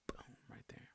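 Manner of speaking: hushed whispered aside; quick whispered boom. Real, not acted. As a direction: drop to a whisper as you point it out. Pointing out background item.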